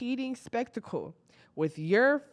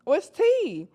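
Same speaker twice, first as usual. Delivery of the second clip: nosy excitement; 12 semitones higher than usual. Retold or imitated. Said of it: "I said".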